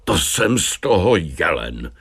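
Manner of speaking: usual speaking voice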